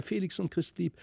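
plain reading voice

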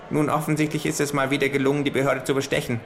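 kangaroo voice. Acted. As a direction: deliver it like a smug know-it-all.

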